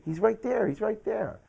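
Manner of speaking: high-pitched urgent insistence, imitated; insistent mom. Mom points out intruder to son, high and insistent.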